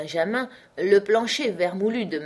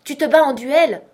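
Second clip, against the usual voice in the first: much higher, louder accusing question; outraged grandmother; 8 semitones higher, 10 dB louder than usual. A narrator's reading. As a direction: scold the nephew in horror. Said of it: "acheva ma grand-mère". (grand-mère furieuse, beaucoup plus fort)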